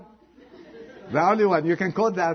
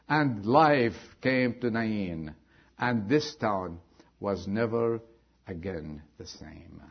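slow, soft dramatic close; 8 semitones lower, 7 dB quieter, slower than usual. Acted. Preacher closing the story for the congregation, slowly and softly. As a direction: lower and softer, drawn out slowly. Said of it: "dramatic slow close of story".

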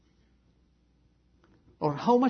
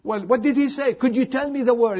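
high, louder pressing questions; raised, urgent mock questioning; insistent worried mother; 9 semitones higher, 4 dB louder than usual. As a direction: act out a mother demanding to know. Mother presses daughter for the word.

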